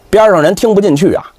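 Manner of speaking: ordinary delivery, nothing marked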